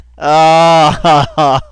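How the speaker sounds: big, loud drawn-out laugh. Real, not acted. Host cracking up at his own imitation, loud laugh. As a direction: burst out laughing loudly.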